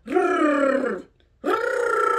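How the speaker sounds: rolled r like a motor; motor sound rolled r. Shown as a demonstration. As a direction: trill like an engine. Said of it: "can you do a noter".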